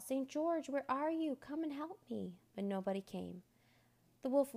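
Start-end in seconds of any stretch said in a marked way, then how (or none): 0.0-2.3 higher-pitched call for help; a mild, high plea for help. A narrator's reading. Scared boy calls for Saint George, high calling out. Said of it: "He called out".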